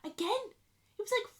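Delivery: usual speaking voice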